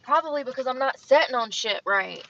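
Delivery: loud exasperated complaint; annoyed outburst; frustrated. Real, not acted. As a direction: grumble it out loud.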